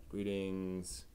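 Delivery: ordinary talk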